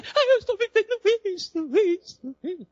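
very high, loud whiny complaint; mock wailing. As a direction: exaggerate a tearful lament in a squealing pitch. Imitates next complainer, wailing. (lamento choroso exagerado, muito agudo)

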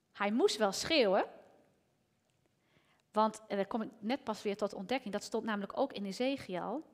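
usual speaking voice

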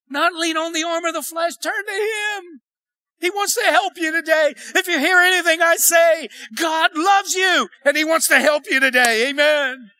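high-pitched, fervent pulpit cry; impassioned preaching. Real, not acted. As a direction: stretch the words and urge the congregation. Impassioned preaching after the testimony.

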